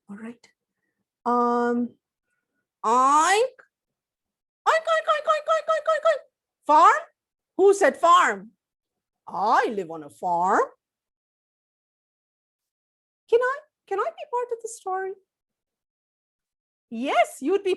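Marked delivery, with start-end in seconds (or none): 2.8-6.2 squealing oink oink; high squeaky piggy oinks. Acted. Pig puppet oinks high. 6.7-15.1 pig voice. Acted. Pig character speaks.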